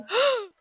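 high, quiet mock gasp; sharp pretend gasp; grandma's shocked gasp. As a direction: gasp like a scandalized grandma in the audience. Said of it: "grandma sitting in the audience like".

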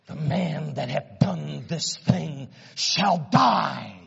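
slow, weighty pronouncement of death; a king passing judgement, drawn out; slow stern verdict. Acted. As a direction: let each word fall like a sentence. David's verdict repeated slowly.

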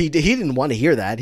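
ordinary talk, nothing marked

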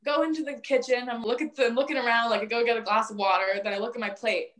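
ordinary talk, nothing marked

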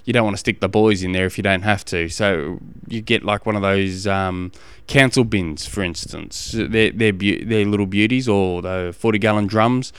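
ordinary delivery

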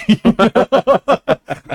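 high burst of real laughter; cracking up at the Jeff Hardy joke. Real, not acted. Laughing at a friend's joke, real high laugh.

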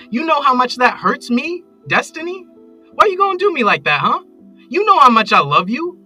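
high, loud, aggrieved voicemail tirade; wronged lover raging into a voicemail; hurt, angry ranting. Acted. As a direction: build from indignant to wounded shouting. Angry voicemail after being hung up on.

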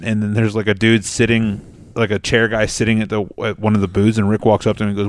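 normal talking voice

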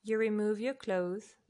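usual speaking voice

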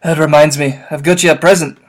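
loud, booming half-giant voice; loud rough gamekeeper voice; Hagrid voice. Acted. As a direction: boom it out like a big-hearted giant in tears. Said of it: "is this me again".

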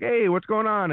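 high, casual stage greeting; easy, chatty hello; conversational. Shown as a demonstration. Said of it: "I'm very con-, conversational".

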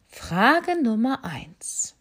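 slow, breathy formal owl. Acted. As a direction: owl starting the test gravely. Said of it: "sagte die Eule". (langsame, gehauchte Eule)

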